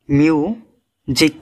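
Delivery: Music slowly in two parts, মিউ and জিক; very slow two-syllable demonstration of music; split in two. Shown as a demonstration. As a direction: break music after মিউ with a clear pause. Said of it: "দুইবারে উচ্চারণ করতে হয় শব্দটা".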